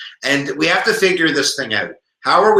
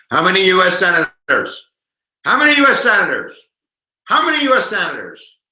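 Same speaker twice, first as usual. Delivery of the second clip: raised, louder repeated quiz call; insistent demand; 4 semitones higher, 4 dB louder than usual. Pretend. Teacher demanding that viewers tweet an answer, insistent. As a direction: badger them for an answer.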